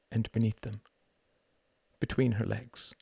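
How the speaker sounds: ordinary delivery, nothing marked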